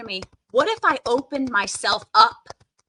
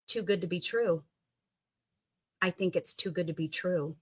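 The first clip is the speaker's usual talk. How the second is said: quiet; 4 semitones lower, 8 dB quieter than usual. Shown as a demonstration. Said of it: "Say it out loud".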